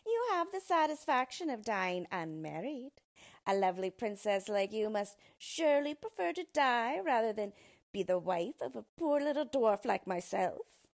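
loud, mocking villain voice; malicious, gloating little dwarf; spiteful dwarf. A narrator's reading. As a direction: taunt the princess with relish. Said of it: "began to laugh spitefully".

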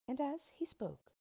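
usual speaking voice

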